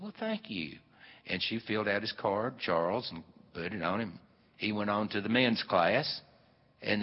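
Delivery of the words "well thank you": soft, high flattered reply; small, high-pitched polite thanks; flattered Eloise. Acted. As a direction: play a woman shyly accepting a compliment. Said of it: "And she said".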